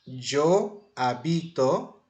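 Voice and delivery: "habito" slow, weight on middle BI; stress on BI. Shown as a demonstration. Teacher gives the verb in a Spanish sentence, slow stress on BI. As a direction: stretch "Yo habito" slowly, stressing a-BI-to. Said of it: "a sílaba tônica tá no bi".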